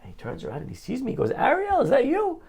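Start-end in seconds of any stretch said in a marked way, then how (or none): 1.3-2.4 high, delighted recognition call; surprised greeting. Retold or imitated. Old friend recognizing him in synagogue, high delighted surprise. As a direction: Jack calling out in happy disbelief.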